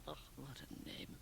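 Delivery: breathy, dismissive murmur; scoffing aside. Acted. Liesl scoffs at the wife's name, hushed.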